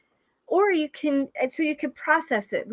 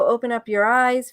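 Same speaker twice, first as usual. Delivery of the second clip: usual speaking voice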